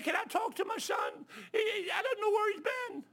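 high, loud, slow crying shout; father sobbing on the phone, loud and high; crying plea. Retold or imitated. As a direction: beg through tears.

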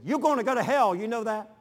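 high, loud urgent warning; fervent plea. Retold or imitated. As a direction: press it on her with all your heart. Mother pleading with neighbor.

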